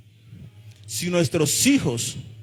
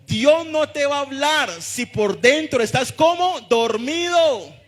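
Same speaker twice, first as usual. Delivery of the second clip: high-pitched, driving sermon delivery; full-force preaching; 10 semitones higher than usual. Real, not acted. Preacher urging the congregation to wake up, impassioned full-force preaching. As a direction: preach it at the top of your register, relentless and rousing.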